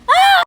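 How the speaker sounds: sudden high squeal; a loud delighted squeal at the ducks; excited shriek. Real, not acted. Squealing at the cute ducks, loud and high.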